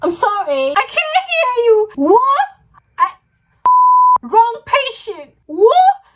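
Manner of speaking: can't believe what the doctor said; stunned disbelief. Retold or imitated. Told she has malaria.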